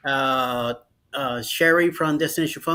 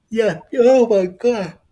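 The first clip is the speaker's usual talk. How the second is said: surprised exclaim; 7 semitones higher, 6 dB louder, slower than usual. Real, not acted. Exclaims while laughing.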